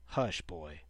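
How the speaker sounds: hushing grandfather. A narrator's reading. Grandfather silencing the boy.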